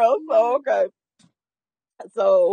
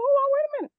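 surprised; 4 dB quieter, much faster than usual. Retold or imitated. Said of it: "I'm like".

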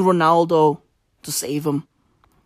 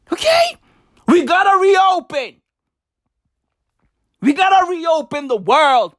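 manic shouting rant; 7 semitones higher than usual. Acted.

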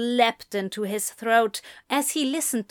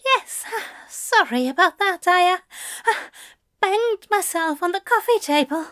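high, breathless flustered excuse; 9 semitones higher, 4 dB louder than usual. Acted. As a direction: stammer out a hasty cover story. Aziraphale flustered, covering up.

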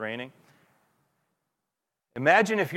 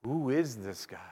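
awestruck; 9 dB quieter than usual. Retold or imitated. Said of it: "the shock and the awe".

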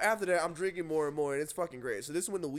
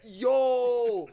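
long, loud, high "yo"; crowd's shocked yell; 6 semitones higher, 9 dB louder than usual. Retold or imitated. Storyteller imitating the whole party seeing him hit, shocked yell. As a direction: holler it like a stunned party crowd. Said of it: "The whole party was that".

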